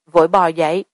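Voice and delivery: ordinary delivery, nothing marked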